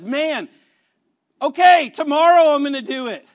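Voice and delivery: loud, high, acted determination; frustrated resolve. Worker who missed morning prayer vows tomorrow, frustrated resolve. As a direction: push it out loudly, vowing to retry.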